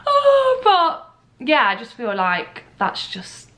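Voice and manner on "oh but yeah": high, louder, crying through the words; tearful. Real, not acted. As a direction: pull yourself together through tears. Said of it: "I'm gonna get emotional".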